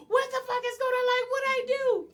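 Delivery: high, loud wail of protest; panicked yelling. Retold or imitated. Retelling her collapse on the porch during arrest, panicked yelling. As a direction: wail it like you're being hauled away. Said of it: "I'm like yelling this shit".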